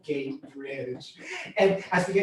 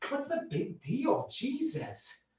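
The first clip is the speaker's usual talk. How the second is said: higher, softer annoyed aside; peeved, pitched-up protest; exasperated grumble; 6 semitones higher, 8 dB quieter, slower than usual. Retold or imitated. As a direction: complain under the ranger's orders. Grumbling while getting off the bike.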